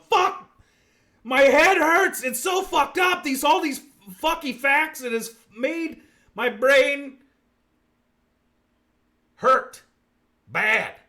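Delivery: high, loud mock whining; hammed-up pained wail. Host imitating viewers whose brains hurt, wailing. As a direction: wail in exaggerated agony, slowing at the end. Acts out listeners' pained complaint.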